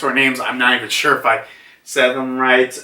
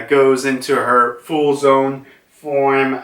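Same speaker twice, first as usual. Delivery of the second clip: usual speaking voice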